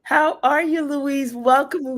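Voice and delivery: high, loud, delighted greeting; excited welcome. Real, not acted. Host welcomes her guest to the show, excited.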